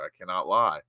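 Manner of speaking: ordinary talk, nothing marked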